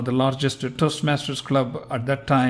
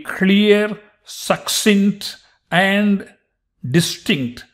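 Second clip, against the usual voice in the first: slow, higher, word-by-word enunciation; clear and distinct; 5 semitones higher, much slower than usual. Shown as a demonstration. Teacher showing learners clear speech, slow and distinct. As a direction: set each word apart deliberately.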